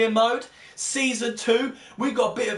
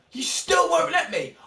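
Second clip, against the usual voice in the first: exasperated; 8 semitones higher than usual. Real, not acted. Frustration at board.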